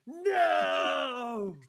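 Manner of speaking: long, high, loud theatrical "no"; dramatic scream. Acted. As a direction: scream it out in hammed-up anguish. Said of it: "and scream".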